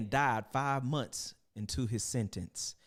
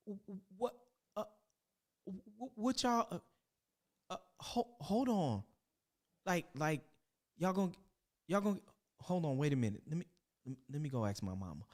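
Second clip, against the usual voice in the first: flustered stammering prince; 4 semitones higher, 5 dB quieter than usual. Acted. Lavelle flustered by bathers.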